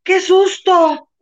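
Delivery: high, drawn-out scared sigh; mock fright. Retelling her scare on the street, slow, high mock fright. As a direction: stretch it slow like a hammed-up fright. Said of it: "Y yo".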